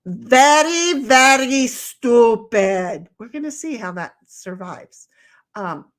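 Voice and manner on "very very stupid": loud, slow, drawn-out chant; class answering in unison. Pretend. Answering her own question for the class, chanted in unison. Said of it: "Everybody say, class, all at one time".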